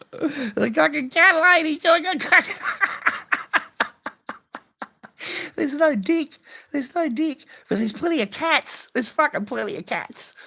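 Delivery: very high, loud shrill mocking squeal; mock cat lady shriek. Mocking the wife as a cat lady in a squealing falsetto.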